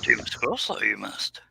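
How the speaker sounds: softened, hushed impression of Yoda; attempted Yoda whisper. Host trying to whisper as Yoda, softly hushed. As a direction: bring Yoda down toward a whisper. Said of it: "That's the best I can do, whispering".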